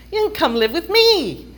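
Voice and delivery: mock mom's high, loud eager offer. Mom jumping at the chance to have her daughter home, eager.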